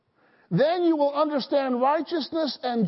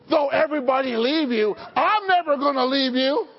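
bold vow; 3 semitones higher, 5 dB louder than usual. Retold or imitated. Peter vows loyalty to Jesus.

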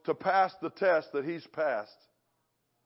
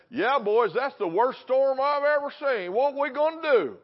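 high-pitched put-on voice, climbing to the final question; hammed-up fearful disciple talk; mock alarm; 7 semitones higher, 3 dB louder than usual. Preacher imitating what Jesus never said about a storm, in high mock alarm. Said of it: "He didn't talk like that".

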